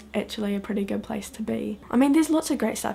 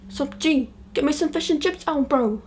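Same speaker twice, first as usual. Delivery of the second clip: higher, casual street-talk caricature; mock Kiwi slang voice; 6 semitones higher than usual. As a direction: play it as a joke version of the language. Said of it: "It goes something like this".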